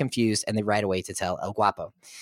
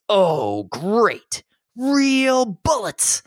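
higher, slowed sarcastic dread; mock-furious and higher on real bullets; dry fury; 9 semitones higher, 4 dB louder, much slower than usual. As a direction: drag out the realization with sarcasm. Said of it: "and he's furious".

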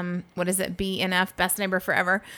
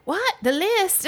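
imitating British guy; 11 semitones higher, slower than usual. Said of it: "And he's like".

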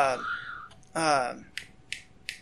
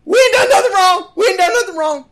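mock panicky plea; 8 semitones higher than usual. Friend pleading to cop.

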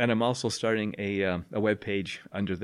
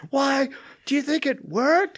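high, loud shouted question; yelling; 4 dB louder, slower than usual. Retold or imitated. Teacher questioning a deafened student, yelling. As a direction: yell as if you can't hear yourself. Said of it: "I'm yelling in the room".

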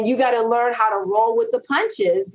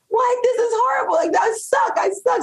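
mock despair.

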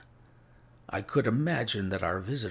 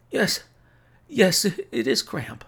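high, loud, halting strained voice; pained sick man; 11 semitones higher, 7 dB louder than usual. A narrator's reading. Sick Holmes admitting cramp, high pained stammer. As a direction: stammer it out through pain.

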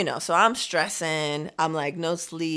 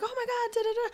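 very high, softish imitation of amazement; retold high-pitched gushing; amazed exclamation; 3 dB quieter, faster than usual. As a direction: skim through his amazed reaction, pitched way up. Retold driver exclaiming, skimmed over.

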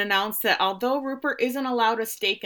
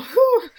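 flustered whew; 13 semitones higher, 7 dB louder than usual. Retold or imitated. Fanning herself over Roy.

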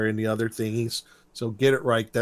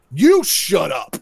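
slow, louder mock retort; indignant; 3 semitones higher, 5 dB louder, slower than usual. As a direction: draw out an indignant comeback. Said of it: "gets all indignant and points at".

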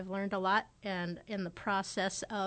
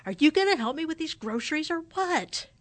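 loud and higher, calling into the house; hollering; 8 semitones higher, 8 dB louder than usual. Retold or imitated. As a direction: yell it in through the front door, fed up. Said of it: "hollered in".

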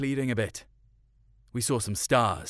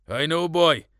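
dad; 5 semitones higher, 6 dB louder than usual. A narrator's reading. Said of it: "Dad answering boy".

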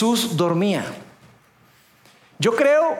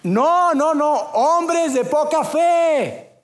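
raised rebuke; 7 semitones higher, much slower than usual. Retold or imitated. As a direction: call it over the storm. Jesus voiced rebuking frightened disciples.

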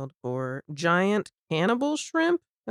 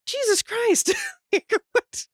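much higher, astonished cry; high amazed exclamations at the price; shocked disbelief; slower than usual. Retold or imitated. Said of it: "She's like".

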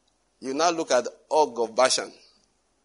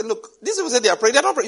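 scoffing remark; 8 semitones higher, 4 dB louder, faster than usual. Retold or imitated. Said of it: "I just say".

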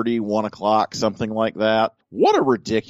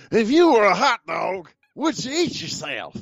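Harry Carey impression; 13 semitones higher than usual. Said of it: "how good is your Harry Carey?".

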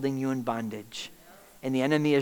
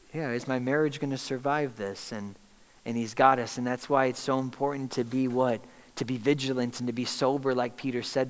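ordinary talk

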